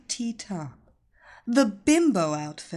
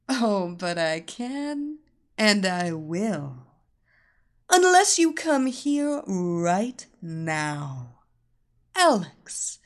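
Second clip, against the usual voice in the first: sultry mistress voice; 5 dB louder, slower than usual. Acted. Mistress taunts wife.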